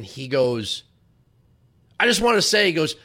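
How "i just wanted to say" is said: a hint of [name], higher and louder; quoting [name]. [name] praising the show, lightly voiced. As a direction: lightly voice the country star's compliment. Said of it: "he said it in his southern drawl".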